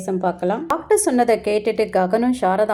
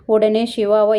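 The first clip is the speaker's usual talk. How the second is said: ordinary delivery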